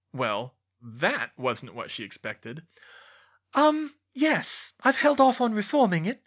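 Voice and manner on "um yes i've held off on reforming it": put-on high, girlish-pitched voice, louder; high-voiced subordinate caught off guard; hesitant Dolores. A narrator's reading. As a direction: hedge in a raised, put-on voice. Caught off guard by boss's question.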